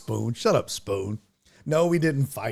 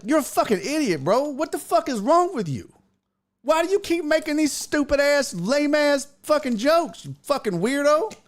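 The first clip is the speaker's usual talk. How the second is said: very high-pitched indignant rant; exasperated retold outburst, pitch way up; heated berating; 13 semitones higher, 3 dB louder than usual. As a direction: re-enact tearing into him. Retells berating the joker.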